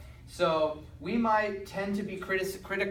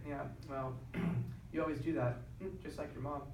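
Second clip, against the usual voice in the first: much softer snide dig; quiet snide jab; 8 dB quieter than usual. Retold or imitated. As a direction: say it coldly under your breath, much softer. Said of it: "couples that were very quiet".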